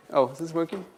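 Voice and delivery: ordinary talk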